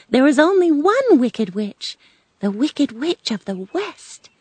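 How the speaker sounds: high, animated storybook witch; informative Good Witch. Acted. Good Witch explains Oz to Dorothy, animated.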